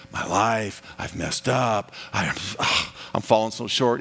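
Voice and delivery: low, halting lament with a sigh; acted dejection, voice dropped low; discouraged confession. Voices a discouraged believer before God.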